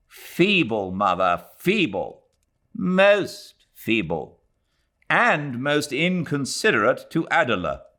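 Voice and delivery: a hint of an irritated son; young man scolding. A narrator's reading. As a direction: slows on feeble, scornful. Ronnie scolds his mother.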